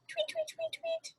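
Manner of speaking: very high, soft tweeting; bird tweets. Retold or imitated. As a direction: chirp like a little bird. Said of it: "some other birds kinda sound like".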